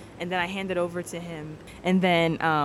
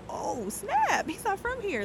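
high-pitched "aha" surprise; mock-amazed discovery, high; retold realization; 13 semitones higher, much slower than usual. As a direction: act out the penny dropping, voice jumping up. Retold sudden realization about his accent.

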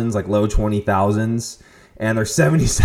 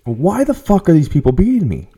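exasperated disbelief; 7 semitones higher, 4 dB louder than usual. Retold or imitated. Rider baffled at being beaten on Peloton, exasperated. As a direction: let incredulous frustration push the pitch up.

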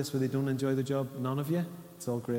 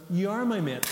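higher, louder playful insistence; girlfriend insisting; 7 semitones higher, 4 dB louder than usual. Retold or imitated. Girlfriend teasing boyfriend, higher and louder. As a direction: insist on it brightly, up high. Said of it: "She went".

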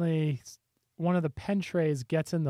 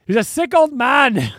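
loud, raised-pitch heckler imitation; mock heckle; 7 semitones higher, 9 dB louder than usual. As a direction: holler it out like the heckler in the crowd. Re-enacts the heckler's shout.

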